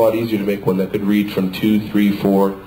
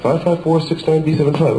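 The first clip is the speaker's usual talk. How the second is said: ordinary talk, nothing marked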